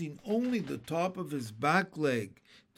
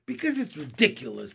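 loud, fast blurt; rushed, indignant retort; exasperated; 7 dB louder, faster than usual. A narrator's reading. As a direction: burst out with it quickly. Boy blurts out his reason.

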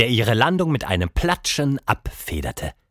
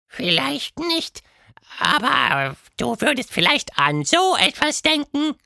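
sehr hoch und langsam, schelmisch; Quatschmonster, verschmitzt; 13 semitones higher than usual. A narrator's reading. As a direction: geheimnisvoll andeuten. (Quatschhund deutet schelmisch an)

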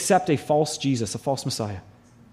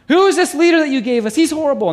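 higher, louder whiny complaint; mock whining complaint; 7 semitones higher, 5 dB louder, faster than usual. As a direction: whine at the leader like a sulking crowd. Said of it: "They started whining against Moses".

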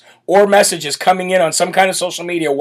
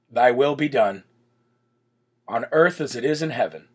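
solemn prayer; 6 semitones lower, 5 dB quieter than usual. Real, not acted. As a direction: pray it unhurried, pausing between phrases. Leads the Lord's Prayer.

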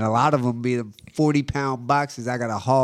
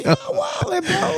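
squeaky high outraged yelp; excited; slower than usual. Real, not acted. Outraged at snack prices.

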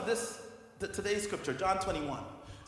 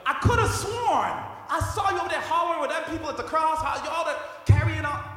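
higher, louder mock accusation; accusing bystander; 5 semitones higher, 4 dB louder than usual. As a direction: call him out like a bystander who recognizes him. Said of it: "another person's like".

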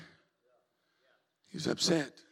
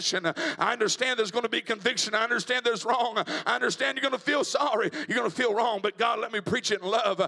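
building preaching; 3 semitones higher than usual. Real, not acted. Preacher praying that his motives match his message, building preaching. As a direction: build steadily into rhythmic, fervent preaching.

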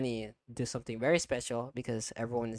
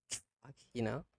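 ordinary talk, nothing marked

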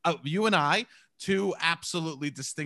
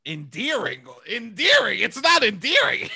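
disbelieving echo that climbs higher and louder; mock incredulous; 6 semitones higher, 7 dB louder than usual. Disputing the cohost's claim that the accent is endearing, mock incredulous. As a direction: build to a laughing, loud protest.

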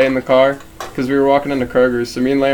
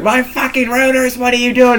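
mock angry cussing; 10 semitones higher than usual. Friend imitates the owner cussing at the car thief, high mock fury. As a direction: rant at him for wrecking your rotors. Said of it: "He keeps cussing".

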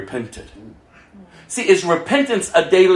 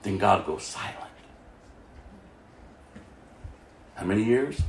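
dropped to a low whisper for the silence of God; hushed storytelling; 13 semitones lower, 15 dB quieter than usual. Acted. Preacher to congregation, telling of the four silent years, whispered and low. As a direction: lean in and whisper it like a secret.

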